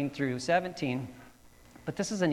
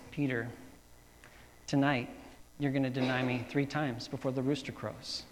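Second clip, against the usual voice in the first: grave and gentle; 2 semitones lower, 7 dB quieter than usual. Acted. Jesus foretells denial to Peter.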